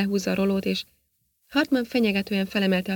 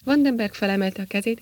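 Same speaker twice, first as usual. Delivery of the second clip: usual speaking voice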